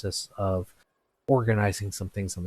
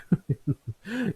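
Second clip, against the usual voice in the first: chuckle; 12 semitones higher than usual. Acted. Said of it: "Ember kinda chuckles".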